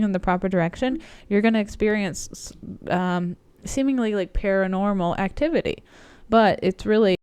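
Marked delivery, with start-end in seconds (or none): 6.3-7.2 about to sneeze. Real, not acted. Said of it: "sorry, I had to sneeze".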